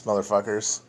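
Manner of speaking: ordinary delivery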